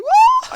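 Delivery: laughing high squeal; mock shriek. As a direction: shriek it like a bratty villain. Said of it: "She's like".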